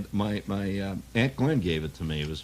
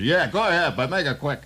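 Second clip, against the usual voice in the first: gruff street mugger, higher and louder; tough mugger; 7 semitones higher, 5 dB louder than usual. Acted. Mugger impatiently tells victim to hurry, gruff. Mugger agrees to time check.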